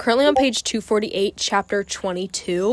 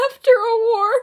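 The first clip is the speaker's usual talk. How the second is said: very high, loud tearful wail; sobbing it out in disbelief; crying and yelling; 14 semitones higher, 4 dB louder than usual. Real, not acted. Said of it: "Screaming, crying".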